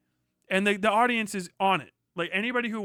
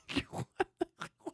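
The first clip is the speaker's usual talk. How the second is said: breathless laugh; 12 semitones higher, 8 dB quieter than usual. Real, not acted. Bitter unvoiced laugh.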